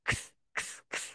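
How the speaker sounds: very soft voiceless "খিস"; ear-whisper. Shown as a demonstration. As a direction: whisper each one like a secret in the ear. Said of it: "কানে কানে গিয়ে কথা বলা". (হালকা ফিসফিস করে)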